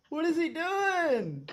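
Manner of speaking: high and slow, half sobbing with laughter; cry-laugh at the awfulness; laugh-crying disbelief. Real, not acted. Streamer watching the salesman fail, high laugh-cry. Reacting to salesman staying put.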